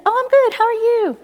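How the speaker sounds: high, loud breezy reply; acted cheery brush-off; over-bright deflection. Deflecting the friend's question back.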